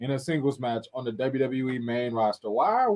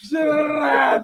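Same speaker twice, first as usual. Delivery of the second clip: high, loud shouted word; 11 semitones higher, 7 dB louder than usual. Real, not acted. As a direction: squeal it out loud. Echoing nickname loudly.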